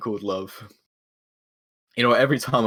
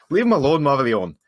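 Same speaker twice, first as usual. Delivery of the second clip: loud, raised call to the ghost; throat cleared, then call out firmly; higher, louder order across the room; bold in-character command; 6 semitones higher, 7 dB louder than usual. Acted. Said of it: "he clears his throat".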